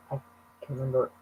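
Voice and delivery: ordinary delivery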